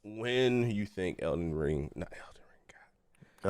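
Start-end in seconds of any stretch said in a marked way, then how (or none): 1.9-2.6 whispered self-correction. Real, not acted.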